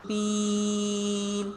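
slow melodic tajweed chant; Quran recitation. Acted. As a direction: recite it slowly and drawn out. Recites with the class.

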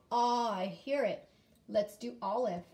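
normal talking voice

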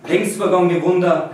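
"vergangene" with broad Tyrolean vowels, "Wunder" as "Wunda"; Tyrolean dialect. Shown as a demonstration. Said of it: "which is like Tyrolean dialect".

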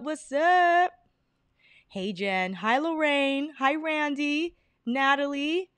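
ordinary talk

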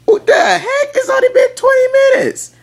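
squeaky high-pitched exasperated yelp; cartoonishly indignant high-pitched outburst; mock outrage. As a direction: shriek it like you can't believe her. Exasperated reply to clingy texting.

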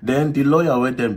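usual speaking voice

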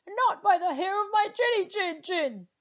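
high, defiant pig voice; squeaky little pig refusing. Acted.

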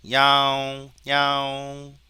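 loud, long-held meow on a level pitch; cat meow imitation. Shown as a demonstration. Teacher recording cat sounds for training, imitated meowing. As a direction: stretch out each meow like a cat. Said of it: "고양이 사운드를 한번 우리가 저장해 보면".

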